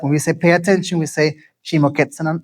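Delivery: ordinary talk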